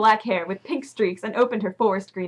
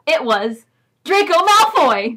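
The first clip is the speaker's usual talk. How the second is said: higher, louder, slowed gasp of a reveal; mock excitement; 6 semitones higher, 7 dB louder, slower than usual. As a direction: ham up the big reveal. Dramatic reveal in fanfic reading.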